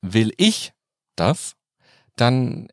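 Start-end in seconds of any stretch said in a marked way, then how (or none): 0.0-1.5 „ich“ steigend betont, Pause vor „das“; stress on ICH. Shown as a demonstration. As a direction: das Wort „ich“ hervorheben. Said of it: "zum Beispiel mit der Betonung". (Betonung auf „ich“)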